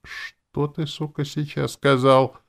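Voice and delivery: high, choked menace; strained, throttled voice, swelling at the end; strangled tone. A narrator's reading. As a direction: squeeze it out slowly with venom. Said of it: "проговорила писательница сдавленным тоном".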